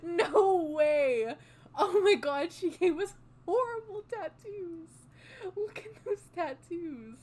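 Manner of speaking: loud, high disbelieving cry; loud, high dismayed shout; shocked outcry. Real, not acted. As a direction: yell at the screen in horror. Horrified at bad tattoos.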